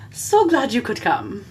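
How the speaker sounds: gracious mock-British hostess; put-on British welcome; British lady. Welcoming guests in a put-on British lady voice. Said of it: "Can you please talk like that".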